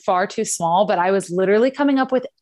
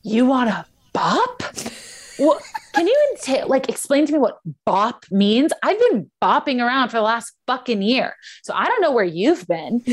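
retold outrage; 5 semitones higher than usual. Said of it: "And I'm just like".

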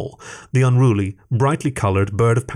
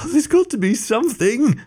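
near tears; 14 semitones higher than usual. A narrator's reading. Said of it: "on the verge of tears".

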